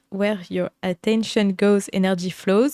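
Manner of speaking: English quote in a French accent; English words spoken with a French accent. Real, not acted. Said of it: "J'ai un magnifique accent anglais".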